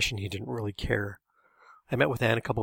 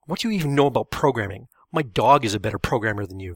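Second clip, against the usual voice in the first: loud, heated taunt; angry shout; 7 dB louder than usual. A narrator's reading. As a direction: shout the insult across. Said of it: "Kenneth shouted".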